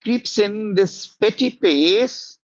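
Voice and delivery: slow, drawn-out line reading; slow dragging verse reading; slow recital. Shown as a demonstration. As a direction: let each word of "petty pace" creep. Teacher re-quotes the line slowly.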